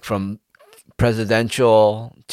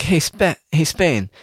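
Chris Hansen; 9 semitones higher, faster than usual. Retold or imitated. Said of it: "if I was Chris Hansen".

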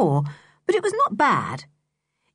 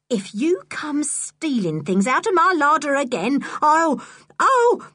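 boze huishoudster; 8 semitones higher than usual. A narrator's reading. Mrs Stick angrily threatens Julian.

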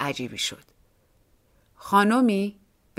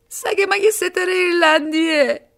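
much higher, louder tearful woman's voice; weeping woman; 11 semitones higher, 6 dB louder than usual. A narrator's reading. Woman tells police about her dog, tearful and high. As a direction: insist through tears, pitched way up.

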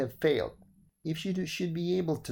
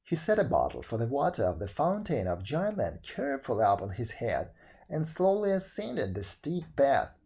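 ordinary delivery

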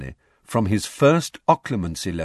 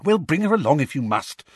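urgent, higher-pitched concession; 6 semitones higher than usual. A narrator's reading. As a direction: press him urgently. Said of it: "said Hermione urgently".

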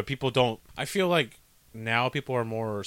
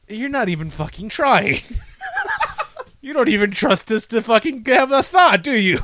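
exasperated rant; 7 semitones higher, 6 dB louder than usual. Real, not acted. Ranting at the movie's writers.

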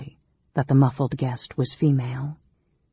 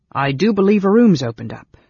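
loud announcement; 4 semitones higher, 6 dB louder than usual. A narrator's reading. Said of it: "announced Sleegart".

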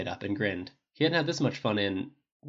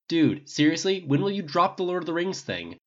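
exasperated; 7 semitones higher, 4 dB louder than usual. A narrator's reading. Archer protests teasing nickname.

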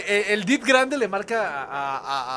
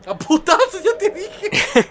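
entre risas; 10 semitones higher, 4 dB louder, much slower than usual. Acted. El tío amenaza riendo a policías.

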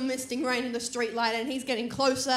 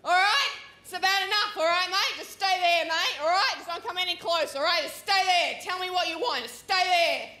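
bluff tough voice; 5 semitones higher than usual. Retold or imitated. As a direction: hold him off with fake bravado. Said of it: "I'm like".

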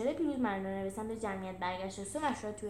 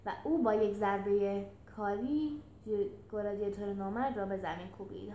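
ordinary delivery, nothing marked

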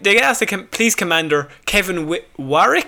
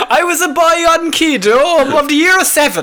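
mock film narrator; 8 semitones higher than usual. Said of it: "The year was nineteen eighty-three".

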